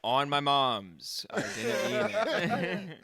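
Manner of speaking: loud, slow, high mock-scared plea through a laugh; frightened kid begging the subway guy; mock pleading. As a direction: beg for mercy, laughing.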